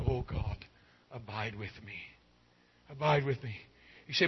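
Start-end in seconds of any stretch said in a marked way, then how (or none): 0.0-0.6 soft prayerful plea. Acted. Voicing a plea at the altar. 1.1-2.0 hushed plea. Acted. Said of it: "come to an altar and cry out". 2.9-3.6 soft prayerful plea. Acted. Voicing a plea at the altar.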